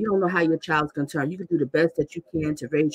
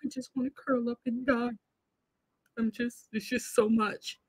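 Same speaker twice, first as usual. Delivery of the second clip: soft, higher, self-pitying mimicry; mocking the mother's bid for sympathy; mock pitiful; 5 semitones higher, 9 dB quieter than usual. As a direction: imitate her wallowing, much softer. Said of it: "Did you just catch that?".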